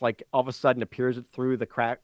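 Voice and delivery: ordinary talk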